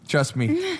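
high cocky trust me; mock confident. Real, not acted. Defending his aim to the teaser, cocky. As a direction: play it up with swagger.

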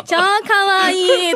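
drawn-out gal voice, slow; slow teen gal voice. Retold or imitated. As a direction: stretch it out like a cute gal. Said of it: "とか言って。". (ゆっくり伸ばすギャル口調)

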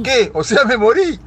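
loud, high acted alarm; shocked. Patient hearing he met Jesus, loud shock. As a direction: blurt it out in mock horror. Joke patient thinks he has died.

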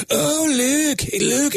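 high Scottish "look" sounding like "Luke"; mock Scottish accent. Friend mimics Mike Myers Scottish for husband. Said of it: "You're like".

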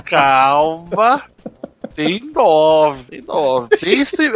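ordinary talk, nothing marked

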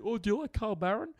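high eager fan voice; gushing question up high; imitating fan. Stranger quizzing a comedian in a high eager voice.

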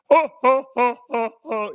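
high, separated hooting mock laugh; Struddy's big laugh. Co-host showing how his friend's laugh goes, imitated big laugh. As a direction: imitate a friend's huge staccato belly laugh. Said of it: "that was your Struddy impression".

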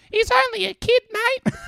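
put-on high, thin kid voice yelling from the bench; kid's yell. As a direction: kid on the bench yells out at the big player. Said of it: "just yelled out".

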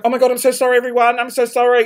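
flustered mock apologizing; mortified apology. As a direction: gush an apology to everyone, reenacted. Reenacts mortified apology to passengers.

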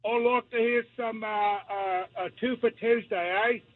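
put-on caller faking a London accent; put-on English accent. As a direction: play a Londoner phoning in. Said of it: "That is a great English accent".

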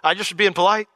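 high, loud, flustered backpedal; hammed-up panic at oversharing; mock backpedaling. Polite asker regretting the question, high and loud flustered. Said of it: "and you're like".

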